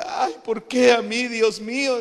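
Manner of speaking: loud, high mock wail; hammed-up slow lament, pitched high; imitating crying child. Child in a meme asks God why, mock crying. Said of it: "sale llorando y dice".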